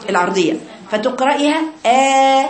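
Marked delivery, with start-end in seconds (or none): 1.8-2.5 "آ" held long with lips stretched sideways; long, level "آ" with a too-wide mouth; horizontal wrong fatha. Shown as a demonstration. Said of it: "اللي هي العرضية دي".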